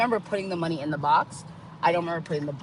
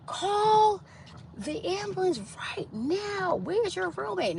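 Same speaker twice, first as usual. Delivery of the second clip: alarmed cousin; slower than usual. Retold or imitated. Cousin reacts to overdose news.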